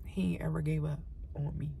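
hushed, breathy confession; softly whispered gratitude. Real, not acted. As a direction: whisper it like a secret prayer. Whispered testimony to God.

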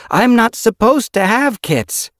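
high, loud voiced character; cat character voice. Yellow Fang pleading.

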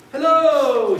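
high, loud storybook shout; shouted greeting. A narrator's reading. Tortoise interrupting the relaxing cactus, shouting. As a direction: holler it out as a greeting. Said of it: "shouted a tortoise".

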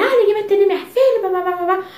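high-pitched mock rant trailing into blah-blah; mock mother yelling. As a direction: imitate an indignant mom making a scene. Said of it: "começou a gritar lá".

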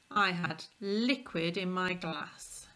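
normal talking voice

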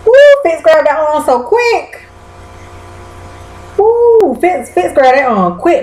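woo shooting very high; shocked excitement. Real, not acted. To the viewers, reacting to Fitz grabbing her arm. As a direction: gasp and rush it out. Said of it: "retelling a shocking scene".

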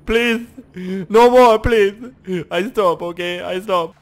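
loud, high hammed-up begging; mock pleading. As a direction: plead for mercy in play panic. Mock begging under heavy fire.

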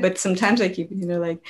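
ordinary talk, nothing marked

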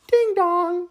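high sung ding-dong chime; doorbell ding dong. Acted. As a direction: sing it like a doorbell. Doorbell sound imitated.

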